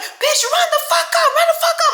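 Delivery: mock a tipsy woman picking a fight; drunk aunt daring someone. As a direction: drunk braider yelling at homegirl's sister, hammed-up. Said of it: "she's like".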